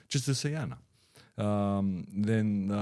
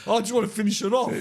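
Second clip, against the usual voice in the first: quick laughing voice; laughing quote; 11 semitones higher, 4 dB louder, faster than usual. Retold or imitated. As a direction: quote her through laughter. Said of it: "She goes".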